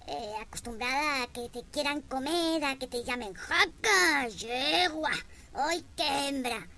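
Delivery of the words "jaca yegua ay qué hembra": loud, higher growl of "jaca, yegua"; rutting-bear catcall. Retold or imitated. Village men catcalling a woman in a loud rutting-bear voice. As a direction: bellow it like a bear in heat. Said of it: "con voz, eh, de oso en celo".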